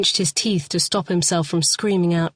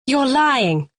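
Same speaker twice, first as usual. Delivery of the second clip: high sharp denial; accusing; 7 semitones higher than usual. A narrator's reading. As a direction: refuse to believe it. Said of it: "said Lee".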